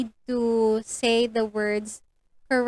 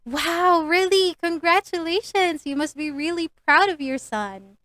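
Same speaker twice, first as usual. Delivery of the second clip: higher and louder, excited congratulations; enthusiastic reaction; 6 semitones higher, 5 dB louder than usual. Shown as a demonstration. Teacher demos the right reaction, excited and warm. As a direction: gush with delight for the parent.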